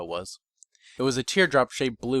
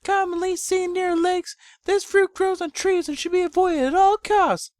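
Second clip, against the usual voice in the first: much higher and slow, gently scolding mother; chastising, motherly, tender; 5 dB louder, slower than usual. Acted. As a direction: warn him tenderly, a mother's light scolding. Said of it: "slightly chastising, motherly, and tender".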